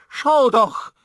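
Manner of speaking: loud, high horrified cry; genuine horror. A narrator's reading. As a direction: cry out to make him look, aghast. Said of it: "In ihrem Ton war echtes Entsetzen". (lauter Aufschrei voller Entsetzen)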